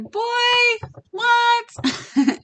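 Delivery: high-pitched mock disbelief; incredulous boy what. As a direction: jump way up in pitch, baffled. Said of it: "And I was like".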